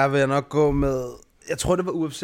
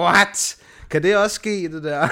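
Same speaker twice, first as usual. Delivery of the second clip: higher and louder, a startled what; surprised disbelief; 6 semitones higher, 6 dB louder than usual. Retold or imitated. Recalling the first draw he ever saw, surprised outburst. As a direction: blurt it out in disbelief. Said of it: "hvor jeg tænkte".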